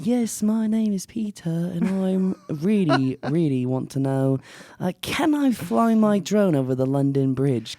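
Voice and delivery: a caller asking about London Bridge, hinting British; mock British accent. Podcaster pretending to be a British caller, light mock British accent. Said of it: "if you don't wanna do an accent".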